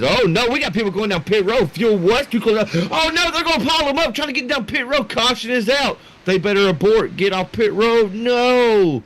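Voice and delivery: high, rising alarmed play-by-play; calling out a pileup as it happens; alarmed commentary. Real, not acted. Caster reacting live to a pit road crash, high alarmed call.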